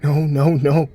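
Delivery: high, loud despairing cry; horrified mother seeing her hurt son; panicked no. A narrator's reading. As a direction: plead against what you see. Sees son crying, hurt.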